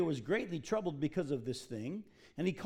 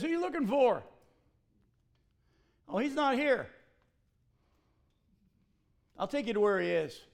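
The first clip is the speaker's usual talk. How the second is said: raised voice, higher and louder at first; calling out; 6 semitones higher, 5 dB louder than usual. Acted. Elisha misdirecting the soldiers who seek the prophet, calling out. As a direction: act out hailing an approaching army, loud, easing slightly. Voicing Elisha addressing the enemy army.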